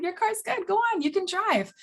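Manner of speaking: hammed-up doctor waving the car off; mock doctor. Mocking doctor sends patient off cheerfully.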